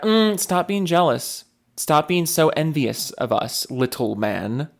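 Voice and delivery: slow, patronizing put-down; mocking condescension. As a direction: talk down to them slowly, mockingly. Mocking the company answering customers.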